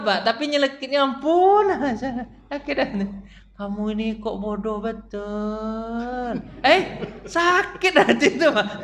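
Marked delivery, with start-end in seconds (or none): 3.5-6.3 slow, soft and sweet-sounding insult; soft gentle put-down. Retold or imitated. Preacher mimics a soft-spoken person wounding someone, soft and slow. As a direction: say a cutting thing softly and slowly. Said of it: "ngomong tuh lembut".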